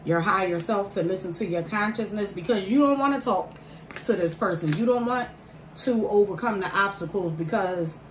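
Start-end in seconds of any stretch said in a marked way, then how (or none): none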